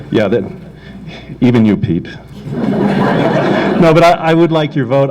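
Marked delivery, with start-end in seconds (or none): 2.4-5.1 laughing. Real, not acted. Laughs after joking at Pete.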